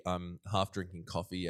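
usual speaking voice

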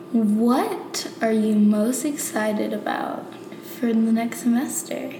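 ordinary talk

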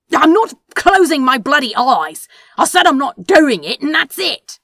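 loud furious shout; angry refusal. Acted. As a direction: slam the refusal down.

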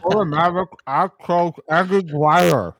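mouthful of candy, garbled words; mouth full. Real, not acted. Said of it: "I can't hear that".

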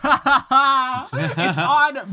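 slow, laughing confession; sheepish laugh. Real, not acted. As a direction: admit it while giggling.

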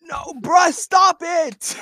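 high yelling denial; loud protest. Real, not acted. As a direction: shout it down. Protesting loudly, laughing.

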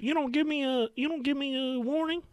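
high-pitched mock Southern woman's whine; southern accent. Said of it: "Is that your southern accent?".

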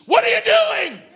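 high, loud outburst; hammed-up appalled shout; exasperated yell. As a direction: shout what is really meant. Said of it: "which really means".

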